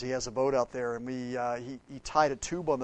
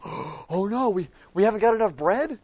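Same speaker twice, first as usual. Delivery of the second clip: mock panic; 7 semitones higher, 7 dB louder than usual. Panicking disciples voiced.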